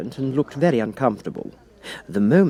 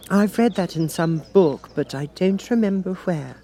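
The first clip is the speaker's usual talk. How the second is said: put-on a young girl quoting a proverb; Alice voice; 8 semitones higher than usual. Alice answers the King, girlish.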